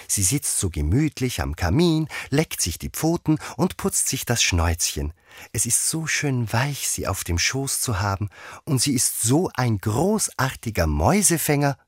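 put-on fond, lifted girl's voice; Alice chattering fondly. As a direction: gush gently about your cat. Alice chatters fondly about her cat.

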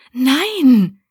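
high, loud, revolted no; cry of disgust. Acted. Abby refusing the dirty wedding dress, loud and disgusted. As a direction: recoil with a loud no. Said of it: "rief Abby voller Abscheu".

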